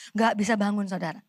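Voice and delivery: ordinary delivery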